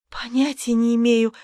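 ordinary delivery, nothing marked

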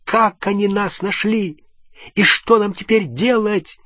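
high, panicked questions. A narrator's reading. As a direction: voice rising in panic. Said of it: "охватившей её паники".

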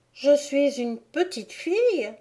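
put-on high, tentative little girl; unsure child's voice, pitched high; unconvinced. A narrator's reading. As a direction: venture it without much conviction. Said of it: "Au hasard et sans beaucoup de conviction".

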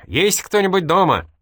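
loud call to the house; shouting. A narrator's reading. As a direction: keep calling out loudly. Still calling to the house.

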